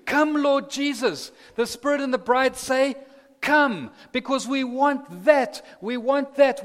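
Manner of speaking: high, loud, longing call; fervent preaching. Acted. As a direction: cry out for the Lord to come. Preaching climax.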